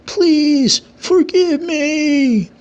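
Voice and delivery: high, very slow, wailing please forgive me; desperate wailing plea. A narrator's reading. Satomu begging the king for mercy, high wailing plea. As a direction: beg for your life, voice climbing high. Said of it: "still pleading to the king".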